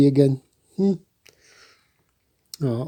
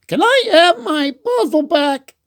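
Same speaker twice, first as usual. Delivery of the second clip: much higher, squeaky little Goose; Goose's voice. A narrator's reading. Goose asking Franklin for her puzzle, high and sweet. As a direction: pitch way up for a small friend. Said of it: "Goose came by".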